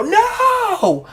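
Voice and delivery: drawn-out, very high wail of protest; anguished cry. Acted. Game master as Ocelot seeing the big guy bitten, anguished cry. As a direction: cry out in acted horror. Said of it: "You hear Ocelot go".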